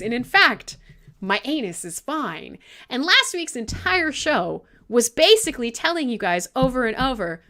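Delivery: normal talking voice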